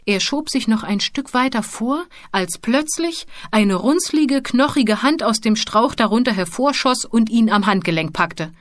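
ordinary delivery, nothing marked

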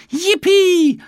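high, loud delighted whoop; a boy thrilled at his find; cheering as the lost case turns up; joyful cheer. Acted. Jonathan finds the pencil case.

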